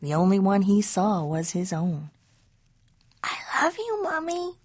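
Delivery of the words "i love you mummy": put-on high, soft, tender child voice; little kitten son. A narrator's reading. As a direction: say it small and sweet to mama. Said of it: "said Skippy John Jones".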